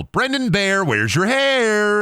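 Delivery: high, drawn-out call; mock a mom calling out, stretching the last word; imitated family yell. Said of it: "my mom and my grandma would just yell".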